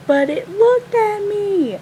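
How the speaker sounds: high, slow, loud whine; mock crying wail. Friend crying back when scolded, high mock wail. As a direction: whine it tearfully, hamming it up. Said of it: "my friend starts crying".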